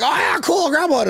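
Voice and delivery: high-pitched, breezy imitation of eagerness; eager retold quote. Acting out his eager earlier self.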